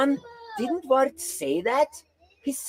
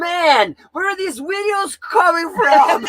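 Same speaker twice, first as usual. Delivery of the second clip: raised, loud mock-bewildered outburst; acted bafflement; 7 semitones higher, 6 dB louder, slower than usual. Re-enacting own reaction to mystery videos, loudly baffled. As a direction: exclaim it high and loud, breaking into laughter.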